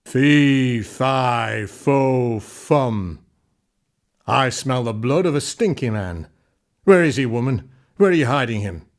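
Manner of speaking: loud, booming giant's roar; bellowing giant. Acted. Giant storming into the kitchen demanding the hidden man, bellowing. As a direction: fill the room like a hungry giant.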